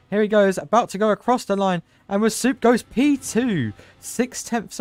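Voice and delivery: excited call. Real, not acted. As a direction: build the finish line call. Commentator calls lap finish.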